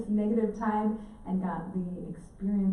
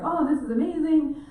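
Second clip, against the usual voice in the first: higher, louder thrilled exclaim; excited; 8 semitones higher, 7 dB louder than usual. Retold or imitated. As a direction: burst out with delight. Excited at finding octopus.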